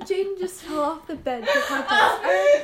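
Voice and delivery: loud, slow, high words through real laughter; half shrieking it out between laughs; laughing. Real, not acted. Announcing to the podcast that Jaden fell off the bed, laughing hard.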